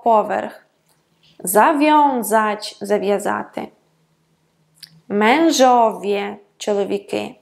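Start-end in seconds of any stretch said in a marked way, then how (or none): 1.4-2.8 zawiązać very slowly, with ą heard as ON; audible nasal "on". Shown as a demonstration. Teacher demonstrating nasal vowels, zawiązać slowly with ON for ą. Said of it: "ми можемо чути як он". 5.1-6.4 mężowie drawn out, ę heard as EN; audible nasal "en". Shown as a demonstration. Teacher giving a Polish example, mężowie slowly with EN for ę. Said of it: "як он і ен".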